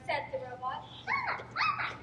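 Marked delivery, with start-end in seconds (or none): 1.0-2.0 very high squeaky little gosling; baby gosling voice. Acted. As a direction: squeal it like a newborn chick. Gosling keeps calling.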